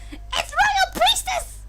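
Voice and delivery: very high, slow screech; Toad voice. Acted. Streamer voicing the royal title in Toad's screech. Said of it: "I think I can do Toad".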